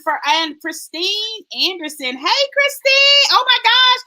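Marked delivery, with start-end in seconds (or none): 2.2-4.0 high, loud, gushing hello; excited, pitched way up; delighted greeting. Real, not acted. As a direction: squeal at a long-lost friend. Delighted greeting to a long-unseen viewer.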